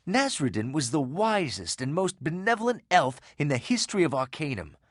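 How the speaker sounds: ordinary talk, nothing marked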